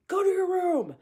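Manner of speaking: high, loud angry command; angry order. Shown as a demonstration. Parent snapping at a child: angry command. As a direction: bark it like a fed-up parent. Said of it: "if we deliver it angrily".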